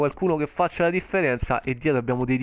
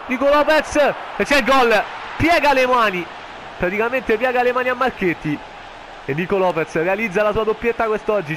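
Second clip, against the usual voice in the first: breathless italian goal commentary; 5 semitones higher than usual. Acted. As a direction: yell the goal like live TV. Goal call. (telecronaca del gol)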